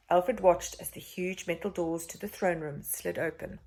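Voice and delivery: usual speaking voice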